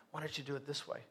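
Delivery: very soft, low nudge; quiet prompting. Acted. God reminding a stubborn believer what to do, quiet prompting. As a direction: give a hushed, low reminder. Voices God's reminder once more.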